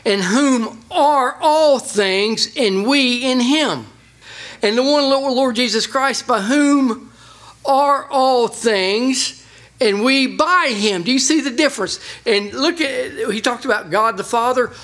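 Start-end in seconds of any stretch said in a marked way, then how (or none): 0.0-11.0 slow, higher, leaning on each small word; emphatic phrasing. Shown as a demonstration. Preacher showing the congregation the wording, slow and emphatic. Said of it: "notice the difference in the phrasing".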